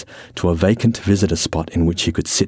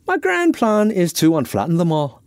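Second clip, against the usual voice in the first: higher-pitched, earnest character voice; Irishman, earnest explainer; 10 semitones higher than usual. Acted. Irishman explains his box plan to Francis, earnest.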